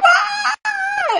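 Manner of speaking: very high, loud scream of wild release; joyful scream. Retold or imitated. Running from the angry friend, screaming with joy. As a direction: let out a shrill playful scream. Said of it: "I still was screaming".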